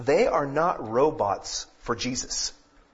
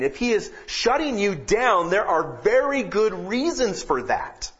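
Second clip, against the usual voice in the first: higher, louder preaching with rising fervor; preaching at full force; 7 semitones higher, 4 dB louder than usual. Acted. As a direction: preach it out, pushing up in pitch and volume.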